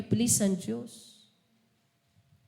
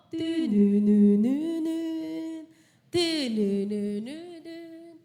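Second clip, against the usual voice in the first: mock sound effect; much slower than usual. Mimicking wallet opening jingle.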